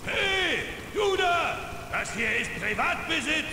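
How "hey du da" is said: shouting at trespasser. Acted. Landowner catches trespasser.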